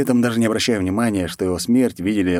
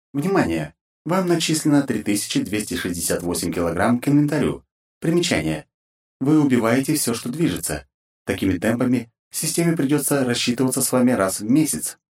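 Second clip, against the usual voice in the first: filtered system notification voice; formal automated alert through a filter; game system announcer; 4 semitones higher than usual. Acted.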